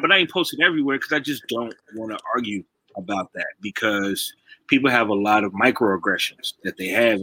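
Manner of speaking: usual speaking voice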